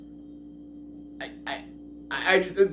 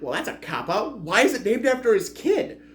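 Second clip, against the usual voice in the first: mock objection; 7 semitones higher than usual. Acts out objection to the title.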